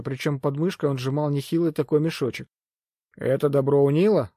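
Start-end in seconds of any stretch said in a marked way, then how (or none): none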